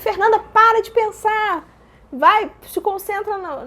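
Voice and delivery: high, slow, emphatic order; urgent command. Retold or imitated. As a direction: drive each word in like a coach. Voices stern self-command in labor.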